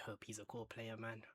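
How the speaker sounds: breathy whisper of a wish; whispered hope. Real, not acted. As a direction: whisper it like a private prayer. Hoping a prospect becomes core.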